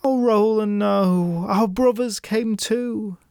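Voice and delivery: high, grieving lament. Acted. Sister tells Roland of brothers, high lament. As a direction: mourn the brothers aloud. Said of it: "she lamented".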